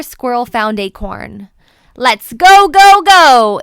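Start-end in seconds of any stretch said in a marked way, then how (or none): 2.0-3.6 loud, rising go go go; cheering. A narrator's reading. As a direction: cheer the squirrel on. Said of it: "Acorn cheered".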